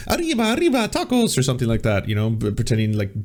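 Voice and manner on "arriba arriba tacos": higher, hammed-up fake Spanish accent; mocking fake Spanish accent. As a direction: toss it off in a cartoon Spanish accent. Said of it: "was just basically like".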